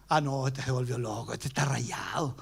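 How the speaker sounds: comentario más bajo y grave, entre dientes; murmullo burlón. Retold or imitated. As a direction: burla por lo bajo del loco. La gente comenta burlona. (la gente murmura burlona, en voz baja)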